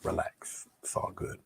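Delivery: soft whisper, calm; whispered reassurance. Real, not acted. Painter whispering to nervous viewers, reassuring. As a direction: hush them gently.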